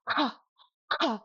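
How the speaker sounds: forceful airy K in "ka"; strong aspiration. Shown as a demonstration. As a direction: say "ka" hard enough to flutter a tissue. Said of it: "aspirate it very strongly".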